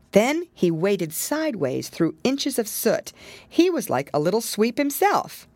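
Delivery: plain reading voice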